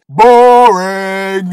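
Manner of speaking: loud, high, long-held jeer; heckling boo. Acted. Viewer jeers at the scene shown instead, heckling call. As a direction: stretch it out like a bored heckler.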